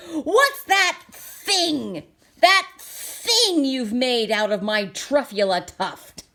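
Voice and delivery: slow, loud, high indignant shout; shouted and puffed. Acted. Lorax demands what the Once-ler made, puffing shout. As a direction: huff and demand an answer. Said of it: "he shouted and puffed".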